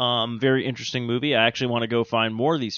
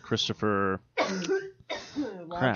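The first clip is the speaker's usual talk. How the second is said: slow fumble; 6 semitones higher, 8 dB quieter, much slower than usual. Real, not acted. Fumbling name, drawn out.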